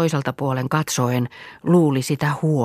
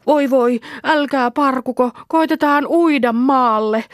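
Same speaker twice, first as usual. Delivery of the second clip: put-on high, louder anxious girl; child in the water; frightened child; 10 semitones higher, 4 dB louder than usual. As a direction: try to calm the others while scared. Girl comforting others in water.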